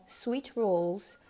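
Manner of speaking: plain reading voice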